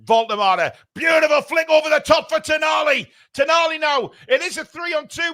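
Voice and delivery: rising live play-by-play; excited commentary. Real, not acted. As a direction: call the attack as it builds.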